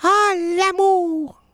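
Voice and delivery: high, drawn-out call, without echo; slow, high call sent far away; mountaintop cry. Shown as a demonstration. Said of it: "j'ai crié comme en haut d'une montagne".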